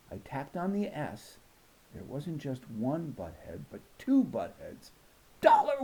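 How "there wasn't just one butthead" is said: usual speaking voice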